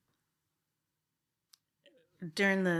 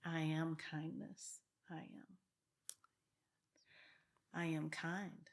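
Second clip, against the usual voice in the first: affirmation demo; 3 dB louder than usual. Shown as a demonstration. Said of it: "So I say things like".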